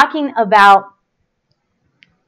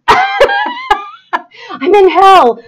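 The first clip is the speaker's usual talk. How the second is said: high, drawn-out, loud lament; exasperated wail; 8 semitones higher, 4 dB louder, slower than usual. Real, not acted. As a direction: wail it, half laughing, half crying. Exasperated by livestream technical trouble.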